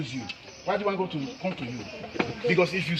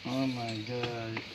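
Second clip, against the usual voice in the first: softer, slow, lower oh my God; hushed awe; 6 semitones lower, 7 dB quieter, much slower than usual. Real, not acted. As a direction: breathe it out in wonder. Awe at child's dream.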